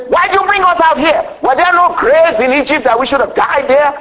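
high-pitched imitation of a panicked protest; mock grumbling complaint. Preacher voicing Israelites trapped at the Red Sea, mock complaining. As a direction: complain like a fed-up crowd, hammed up from the pulpit. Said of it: "they began to grumble and complain".

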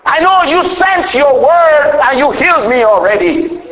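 high, slow, ringing declaration; proclaiming faith. Pretend. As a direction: declare it boldly, stretching each word. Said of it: "You can say".

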